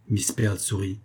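usual speaking voice

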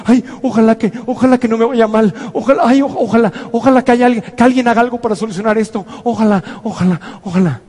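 whiny, helpless mock pleading; fearful wishing. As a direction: play someone anxiously wishing to be rescued. Acts out facing life fearfully.

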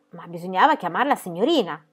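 ordinary delivery, nothing marked

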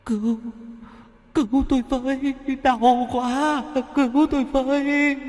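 high, soft wailing plea for help; a wailing ghost begging from far off; ghostly wailing cry. A narrator's reading. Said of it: "nghe tiếng khóc phát ra".

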